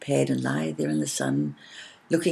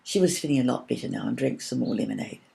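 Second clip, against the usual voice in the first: usual speaking voice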